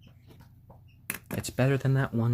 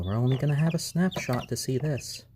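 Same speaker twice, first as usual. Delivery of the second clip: ordinary talk, nothing marked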